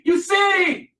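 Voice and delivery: high-pitched preacher's shout; preaching shout. Real, not acted. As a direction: call it out to the church.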